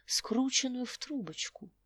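usual speaking voice

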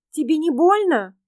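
put-on a concerned little girl. Girl's voice.

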